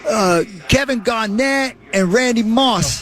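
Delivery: put-on slow, drawn-out Boston accent. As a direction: lay on a Boston fan accent. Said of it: "That's my Boston accent".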